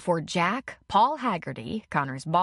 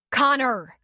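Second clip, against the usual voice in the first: higher, loud sharp shout of his name; 6 semitones higher, 6 dB louder than usual. A narrator's reading. Woman shouting from the porch at her man, sharp shout. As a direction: call him off from a distance. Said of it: "Leslie shouted from the porch".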